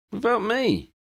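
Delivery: high, indignant protest. Acted. Ron protesting to Ginny, high indignant whine. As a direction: whine it as an outraged question. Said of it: "Ron protested".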